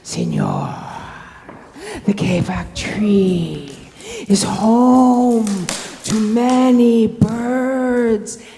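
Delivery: slow, growled big-cat voice; drawn-out jaguar growl, swelling on key words. Acted. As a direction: growl it slowly into his ear. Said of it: "He growled in his ear".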